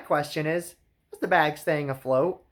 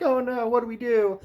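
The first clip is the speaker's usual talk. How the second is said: mock helpless girls; 7 semitones higher than usual.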